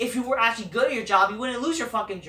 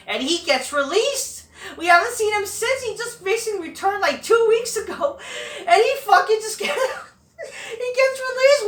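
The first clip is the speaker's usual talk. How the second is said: high, loud exasperated rant with a laugh; real outrage, squeaking high and loud; incredulous outrage; 11 semitones higher, 6 dB louder than usual. Real, not acted. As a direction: pitch it way up in disbelief. Outraged rant at a sudden release.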